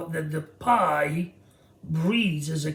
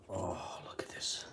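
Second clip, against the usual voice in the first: awed whisper; 19 dB quieter than usual. Real, not acted. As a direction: breathe it out in wonder. Awe over the baked calzone.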